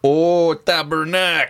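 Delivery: a Québécois curse with French vowels; Quebec accent. Pretend. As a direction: swear like a Quebecer. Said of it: "someone from Quebec".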